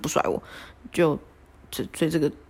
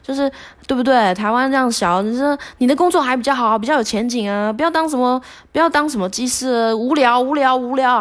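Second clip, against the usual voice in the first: higher, scoffing coach voice; 6 semitones higher than usual. Retold or imitated. Coach mocking airline pilots to cheer her, higher scoffing. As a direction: wave away the pilot dream with scorn. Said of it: "他说".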